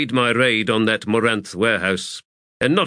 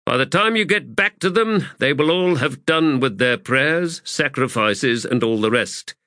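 higher, projected formal address; officer briefing a whole company; raised voice; 6 semitones higher than usual. A narrator's reading. High Fist continues telling sappers about the advance, raised formal voice. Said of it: "and then raised his voice".

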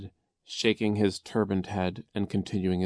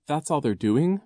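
higher, rising doubtful question; suspicious; 8 semitones higher, 6 dB louder than usual. A narrator's reading. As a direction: question it warily, voice lifting. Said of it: "Klaus said suspiciously".

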